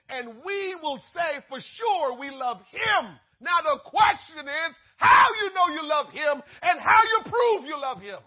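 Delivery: loud, crying preacher's shout; impassioned pulpit shout with a break in the voice; preaching at full force. Acted. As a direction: cry it out to the whole congregation.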